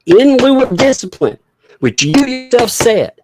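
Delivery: loud, high, incredulous protest; high, emphatic exasperation; exasperated rebuttal. Real, not acted. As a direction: raise your voice and argue back. Exasperated rebuttal of a filing read aloud.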